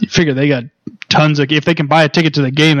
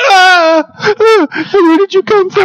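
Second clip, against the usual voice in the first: startled; slower than usual. Retold or imitated.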